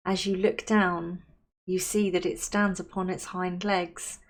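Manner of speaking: unhurried, soothing visualisation, softening at phrase ends; guided meditation. Acted. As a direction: guide them gently into calm, leaving space to breathe.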